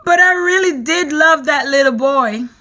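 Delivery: put-on crying earthworm mourns the boy; sobbing. A narrator's reading. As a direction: sob out the grief. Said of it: "the earthworm sobbed".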